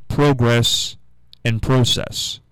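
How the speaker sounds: progress and process both starting "pro"; long-o pronunciation. Shown as a demonstration. Host saying what it should be, long-o progress and process. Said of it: "it should easily be".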